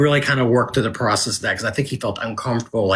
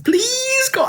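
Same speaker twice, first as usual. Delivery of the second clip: much higher, begging Please call; mock desperate plea. Author mimics narrator's too-desperate Kate, mock pleading. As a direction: ham up a needy, pleading boy. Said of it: "he had Kate a little desperate".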